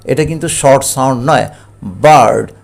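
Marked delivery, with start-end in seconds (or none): 1.8-2.5 বার্ড with a long ar sound; teacher showing the long vowel in bird. Shown as a demonstration. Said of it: "long sound".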